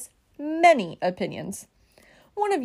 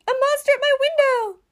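high-pitched, loud alarm; frightened shout; 5 dB louder than usual. A narrator's reading. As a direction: cry out in storybook panic. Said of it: "shouted Baby".

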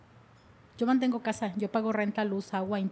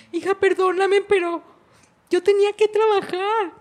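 higher and louder, voice breaking into a cry; tearful apology; 9 semitones higher, 7 dB louder than usual. Retold or imitated. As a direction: plead through tears for forgiveness. Voices herself begging daughter's forgiveness. (pidiendo perdón a su hija entre lágrimas, más agudo y fuerte)

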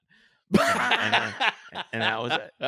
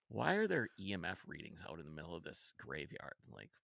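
low, hushed retold inner thought; quietly puzzled thinking aloud; uneasy musing; 5 semitones lower, 14 dB quieter than usual. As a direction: wonder softly to yourself, trailing lower. Retelling his own uneasy inner thought.

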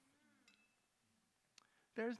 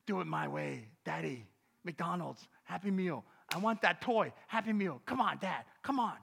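put-on louder, pushy child's nagging; insistent daughter; 8 dB louder than usual. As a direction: demand the Happy Meal from Dad. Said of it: "insistent intercession".

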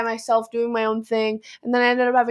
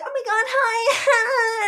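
much higher squealy mock gushing; imitating clingy girl; 12 semitones higher, much slower than usual. Mocking the girl who grabbed her leg, high squeal. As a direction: gush like an overexcited clingy classmate. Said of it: "she'd be like".

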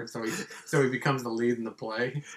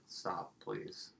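whispered plea; 5 semitones lower, 13 dB quieter than usual. Retold or imitated. Quoting Sam Raimi.

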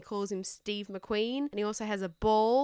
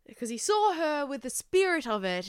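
ordinary talk

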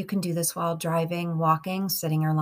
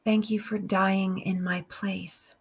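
slow, measured meditation with long pauses; guided prayer; slower than usual. Real, not acted. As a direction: pray phrase by phrase, unhurried, leaving room to repeat.

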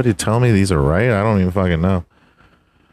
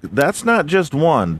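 usual speaking voice

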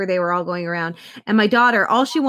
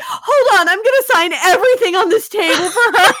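much higher and louder, laughing through it; excited laughing quote; 10 semitones higher, 7 dB louder than usual. Retold or imitated. Mom quoting actor's delighted promise, excited and laughing. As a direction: gush it out between laughs.

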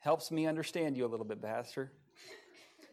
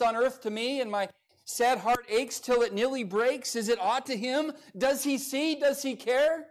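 high, loud preaching of the verses; impassioned hymn recitation; 8 semitones higher, 6 dB louder than usual. Acted. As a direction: declaim each "Does Jesus care" like a pulpit cry. Recites hymn lyrics from the pulpit.

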